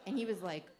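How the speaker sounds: ordinary talk, nothing marked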